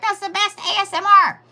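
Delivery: high put-on voice quoting Sass; lightly voiced friend, pleased; imitating Sass. Friend Sass praising the angry typing, high voice. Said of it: "She's like".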